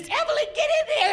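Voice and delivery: put-on very high, fast old lady call. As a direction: squeal at a friend to get in the photo. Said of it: "they're taking pictures".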